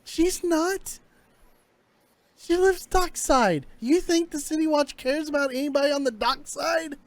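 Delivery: high-pitched, exasperated disbelief; incredulous scoffing. Acted. Captive dismissing a threat of the City Watch, incredulous.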